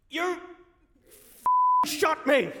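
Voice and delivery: high, explosive shout; acted yell of a wounded dad; outraged swearing. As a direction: burst out in shocked fury. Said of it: "first time I've ever heard him swear".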